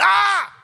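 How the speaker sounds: very loud, high shriek; startled scream. Retold or imitated. Storyteller seeing a rat under the plates, startled scream.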